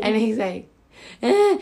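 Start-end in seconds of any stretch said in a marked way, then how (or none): 1.2-1.6 short high-pitched whiny bleat; mock child's whine. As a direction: whine back like a toddler.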